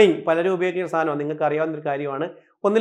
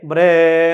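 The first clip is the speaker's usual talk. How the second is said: "ബ്രേ" loud and long-drawn; imitated donkey bray; 8 dB louder, much slower than usual. Shown as a demonstration. Teacher imitating a donkey's cry, loud drawn-out "ബ്രേ". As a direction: stretch the vowel of "ബ്രേ" loudly, like a donkey. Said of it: "കഴുത കരയുന്നത്".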